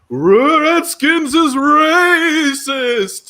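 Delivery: high, long drawn-out ghostly wail; weird apparition. Acted. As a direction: wail it slowly like a haunting apparition. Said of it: "like a weird, like, apparition".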